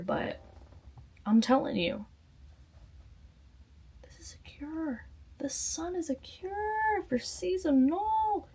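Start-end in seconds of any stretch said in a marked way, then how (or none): 4.0-5.1 soft whisper, cure falling; whispered secret. Real, not acted. Confiding sunshine helps depression. 5.4-8.5 higher and slow, drawn-out sing-song; high stretched. Real, not acted.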